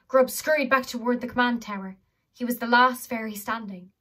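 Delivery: ordinary delivery, nothing marked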